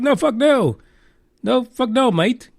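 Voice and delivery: high-pitched mock Australian accent, brash refusal. Australian officials refusing David Icke entry, mock Australian accent. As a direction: bark it out like a blunt Aussie border guard, Australian accent. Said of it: "That's as far as my Australian accent goes".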